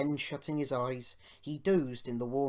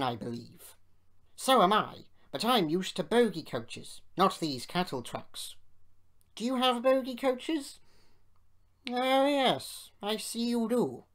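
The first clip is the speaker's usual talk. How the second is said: self-important engines chatting; 6 semitones higher, 6 dB louder than usual. A narrator's reading. Two engines converse.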